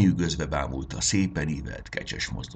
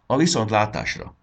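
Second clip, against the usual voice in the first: higher, louder parting call; the fairy queen sending the boy home, ringing; ringing farewell; 6 semitones higher, 7 dB louder than usual. Acted. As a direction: send him off with the spell.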